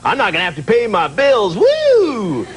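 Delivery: loud, high, rowdy celebration; gleeful whooping. Acted. People thrilled they won't have to pay bills, gleeful high shouting. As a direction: whoop it up, stretching the big words. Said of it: "some people are like".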